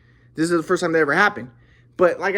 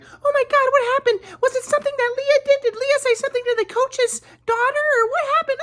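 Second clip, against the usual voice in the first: hammed-up falsetto panic, very high; mortified mom; 3 dB louder than usual. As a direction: imitate a mother freaking out in a squeal. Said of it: "My mom was all mortified".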